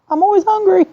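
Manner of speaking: high laughing whine. Retold or imitated. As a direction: squeak it out through laughter. Quoting herself, laughing.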